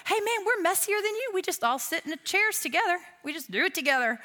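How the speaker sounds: high excited coaxing; eager pitch. Retold or imitated. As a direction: plead brightly, up high. Her eager reply to a friend.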